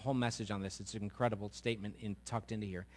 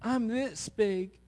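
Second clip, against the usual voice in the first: put-on higher, slow small child; higher slow child's voice; little boy; 10 semitones higher, 4 dB louder, much slower than usual. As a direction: sound small and unsure.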